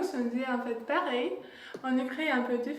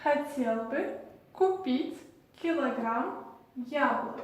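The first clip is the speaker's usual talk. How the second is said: "я хотел бы купить килограмм яблок" slowly, word by word; slow repetition. Shown as a demonstration. Teacher having learners repeat the shopping sentence, slow repetition. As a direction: model the Russian sentence slowly with clear pauses for repeating. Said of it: "Donc on répète".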